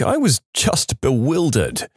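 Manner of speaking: ordinary delivery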